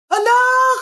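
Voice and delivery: loud, high cry of a grieving mother; anguished wail. Acted. Mother wailing her son's name when told he died, loud and high. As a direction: cry out for the dead child. Mother wails son is dead.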